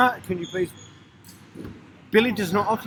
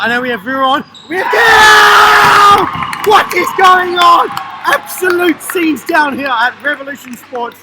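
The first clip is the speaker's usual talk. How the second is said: high, huge drawn-out shout; excited goal call; 9 semitones higher, 10 dB louder, slower than usual. Real, not acted. Commentator calling a goal, roaring excitement. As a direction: build to a long roar, then announce the scenes.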